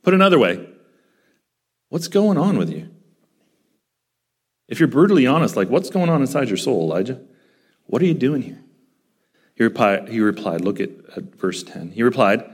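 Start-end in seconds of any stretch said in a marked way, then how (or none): none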